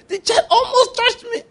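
mock panic in a high squeaky voice; scared monster. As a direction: squeal like a big monster spooked by a kid. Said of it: "No, he said".

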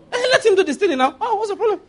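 high, fast, laughing jibe; high mock question with a laugh; teasing mockery. Real, not acted. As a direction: tease them with a high laughing voice. Mock challenge to angry listener.

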